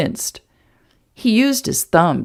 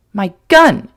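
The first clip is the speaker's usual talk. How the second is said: short, louder snap; snapped; 2 semitones higher, 7 dB louder than usual. Acted. Woman snapping at the man about her gun. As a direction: bite the words off angrily. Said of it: "she snapped".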